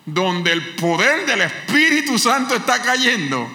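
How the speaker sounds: high, loud preacher's cry; fiery preaching. Acted. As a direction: preach it out to the church. Preacher at full force. (predicación con fervor)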